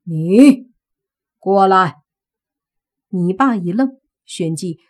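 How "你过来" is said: grim command. Acted. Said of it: "脸色阴沉".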